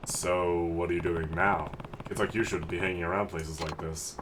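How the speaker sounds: low, understated game hero; older man, mildly reproving. Acted. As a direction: quietly lecture a kid as the tough older guy.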